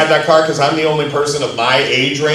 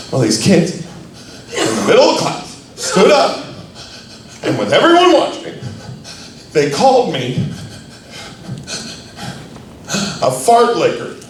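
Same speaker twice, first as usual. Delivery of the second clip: put-on bullied teen sobbing out his complaint, high and halting; hammed-up weepy confession; mock bawling; 4 semitones higher than usual. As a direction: imitate a tearful kid, pitch jumping up between pauses. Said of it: "crying his eyes out".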